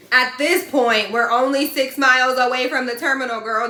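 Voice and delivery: loud, high exasperated complaint; venting hard, voice up and loud. Real, not acted. Complaining about the trainer, loud and exasperated.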